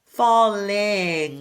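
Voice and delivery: "Falling" with the tongue tip on the gum line. Shown as a demonstration. Coach models falling with careful tongue placement. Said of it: "Tip of my tongue is resting on the gum line".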